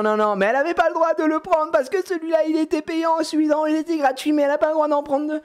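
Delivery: put-on high, unbroken child wail; put-on high-pitched tantrum; insistent little girl. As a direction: mimic a kid arguing at full pitch. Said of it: "elle se met à crier".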